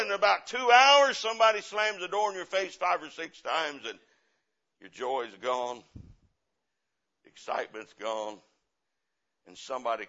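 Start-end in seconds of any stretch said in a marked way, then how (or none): 0.0-3.8 loud, high, driving preacher's warning; full-force preaching. Acted. Preacher warning the church about persecution, in a loud driving sermon voice. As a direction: hammer the warning home to the congregation.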